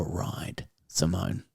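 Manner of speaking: whispered end of a read letter. Acted. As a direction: drop into a breathy whisper on the sign-off.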